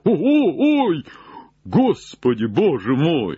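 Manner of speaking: high, drawn-out alarmed wail; startled cry. Acted. Jupiter finds something in the tree, alarmed cry.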